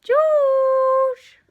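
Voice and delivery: high loud call to a cat. Real, not acted. Owner calling her cat across the room, loud high call. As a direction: sing out the cat's name, high and loud.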